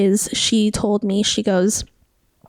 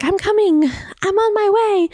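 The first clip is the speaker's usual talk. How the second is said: high, drawn-out mock call on the run; eager calling out; 10 semitones higher than usual. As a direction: call ahead in a raised, eager voice. Holy Spirit voiced running up.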